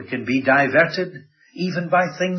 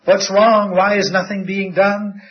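pleading cry, louder and higher, voice rising; crying out to God; 6 semitones higher, 6 dB louder than usual. Retold or imitated. Prophet crying out to the Lord, loud pleading. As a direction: call out to the Lord in desperation. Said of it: "he cries out to the Lord".

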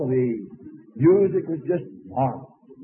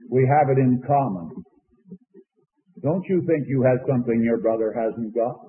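ordinary delivery

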